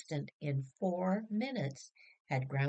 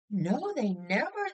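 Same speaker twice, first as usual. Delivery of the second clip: eager chorus answer; 6 semitones higher, 5 dB louder than usual. Acted. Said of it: "they were all answering".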